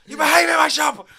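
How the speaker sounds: very high, very loud hammed-up scream; mock furious shout. Shop worker screaming at a child, hammed-up fury. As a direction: explode like a raging shopkeeper. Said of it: "then you just, just go".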